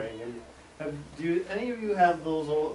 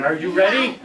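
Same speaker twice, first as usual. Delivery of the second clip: loud, raised French-Canadian question; thick French accent; 4 semitones higher, 8 dB louder than usual. Retold or imitated. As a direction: ask it eagerly in a French accent. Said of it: "very thick French accent".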